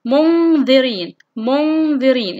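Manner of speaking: slow منذرين with long hummed noon; ikhfa demonstration. Shown as a demonstration. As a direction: hold the nasal before the ذ in منذرين. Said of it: "هنا يقول لك". (إخفاء النون بغنّة ممدودة في منذرين)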